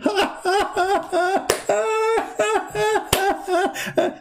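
a long, high, gleeful devil's laugh; high mock devil cackle. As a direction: cackle like Shaitan gloating inside a mouth. Shaitan laughing in the mouth.

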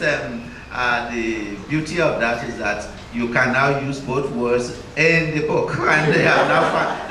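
normal talking voice

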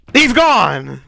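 high, loud burst of glee; gleeful yell of victory; triumphant shout. Real, not acted. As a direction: crow over the kill. Player knocks enemy off.

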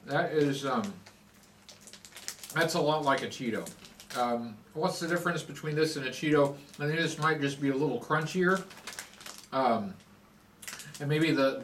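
talking while chewing snack; words muffled by food; mouth full, chewing. Real, not acted. Seen 4: Eats a puff at -5; cheek bulges, chewing motion during speech.

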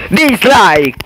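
sudden loud dislike; shouted dislike. Real, not acted. Gamer shouting his verdict on the level, loud and disgusted.

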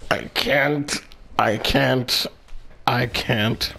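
low, hushed disbelief; quiet, low, overwhelmed repetition; stunned muttering. Real, not acted. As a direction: repeat it softly, lost for words. Speechless over the damage.

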